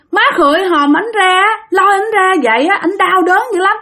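high-pitched mock sobbing, pleading throughout; imitated tearful wailing. Wife begs family not to open the coffin, crying wail. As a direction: act out a widow wailing in protest. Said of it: "Mà vừa khóc vừa gào lên".

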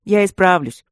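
put-on young man promising the gargoyles. A narrator's reading. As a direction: promise it firmly. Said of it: "пообещал Ситников".

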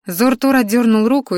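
usual speaking voice

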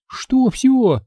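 high, frightened little squeak. Acted. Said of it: "испуганно пискнула Светка".

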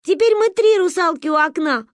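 delighted. A narrator's reading. Girl cheers with her friends, high and happy. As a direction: cheer it with glee. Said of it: "обрадовалась я".

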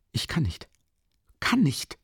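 irritated, jumping up high on the repeat. A narrator's reading. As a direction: repeat it testily, pitch leaping. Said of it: "wiederholte er gereizt".

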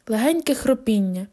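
ordinary delivery, nothing marked

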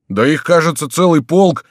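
loud, high exclamation; alarmed shout. A narrator's reading. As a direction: shout at the enemy's growing numbers. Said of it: "закричал начальник караула".

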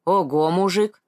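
mildly amazed exclamation; a touch of astonishment; impressed gasp. A narrator's reading. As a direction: let out an admiring wow. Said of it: "и Николай ахнул".

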